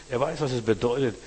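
usual speaking voice

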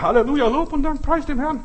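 high, loud hallelujah cry; put-on joyful praise, pitched high; mock jubilation. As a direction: exclaim praise way up high, hammed up. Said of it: "eigentlich sollten wir sagen".

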